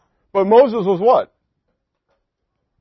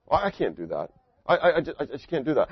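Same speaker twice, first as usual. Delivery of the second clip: imitating nervous refusal; 3 semitones lower, 8 dB quieter than usual. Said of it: "I hear that from people".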